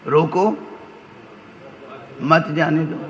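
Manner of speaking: "روکو" set apart with a rise, pause, then "مت جانے دو" as one phrase; stop-them reading. Shown as a demonstration. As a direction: pause right after "روکو", keep "مت" with "جانے دو". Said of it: "کس لہجے میں کہی جائے".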